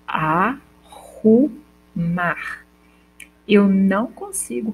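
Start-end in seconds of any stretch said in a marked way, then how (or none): 0.0-1.5 arrumar bem devagar, um só r forte; slow demo. Shown as a demonstration. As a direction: alongue arrumar mostrando um R. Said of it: "quando eu falo arrumar". (arrumar dito devagar com um só som de R)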